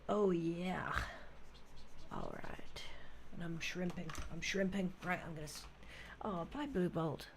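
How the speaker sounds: ordinary talk, nothing marked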